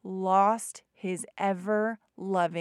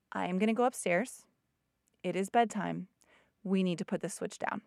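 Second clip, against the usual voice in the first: measured, even delivery with pauses; calm; 4 dB quieter than usual. Retold or imitated. Mom telling upset son it's bedtime, calm. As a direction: calmly set a limit, softening toward the end. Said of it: "I just calmly said".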